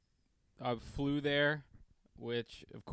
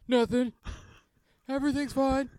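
much higher, louder forced calm; fake-calm high voice; 14 semitones higher, 5 dB louder than usual. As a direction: pretend everything's fine, high. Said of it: "they're like".

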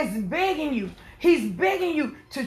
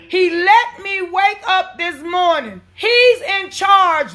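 raised, emphatic sermon voice; full-force preaching; 4 semitones higher, 5 dB louder, slower than usual. Real, not acted. Preacher testifying to the congregation that God is in charge, full-force preaching. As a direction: proclaim it to the congregation, stretching the last words.

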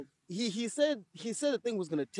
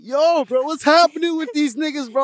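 excited call-out; 5 semitones higher, 8 dB louder than usual. Retold or imitated. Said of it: "just asking".

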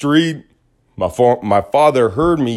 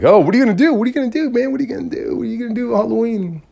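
much higher, pestering question; mock nagging friends; 10 semitones higher, much faster than usual. Friends pestering about Halloween plans, high mock voice. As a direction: chirp it again and again. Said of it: "you know, like".

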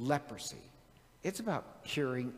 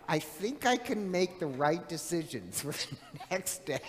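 laughing through a retold retort; 3 semitones higher than usual. Real, not acted. Son retelling his mother's comeback, laughing. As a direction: crack up while quoting mother. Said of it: "she can get me laughing".

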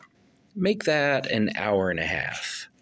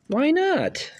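surprised. Acted. Reacting to her doubt.

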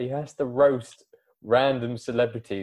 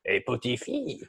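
put-on French accent; 2 semitones higher, 4 dB louder than usual. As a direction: ham up a French villain. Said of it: "French kidnappers going".

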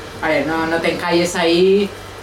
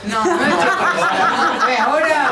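much higher, laughing; 10 semitones higher, 4 dB louder than usual. Real, not acted. Guest conceding to the man, laughing.

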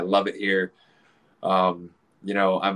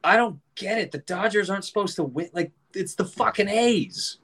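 put-on much higher, whiny kid voice; little kid complaining, pitched way up; confused child; 10 semitones higher than usual. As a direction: whine like a baffled child. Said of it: "I was like".

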